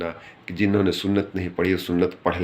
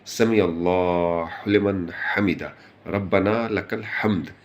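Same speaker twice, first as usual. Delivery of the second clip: slow, drawn-out prayer recitation; measured recitation; slower than usual. Shown as a demonstration. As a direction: stretch the long vowels, unhurried. Demonstrates prayer words at proper pace.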